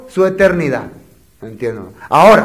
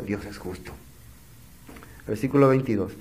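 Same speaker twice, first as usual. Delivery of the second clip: usual speaking voice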